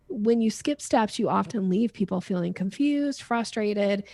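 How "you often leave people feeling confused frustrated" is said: ordinary talk, nothing marked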